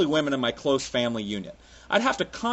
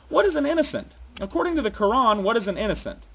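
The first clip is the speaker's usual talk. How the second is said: usual speaking voice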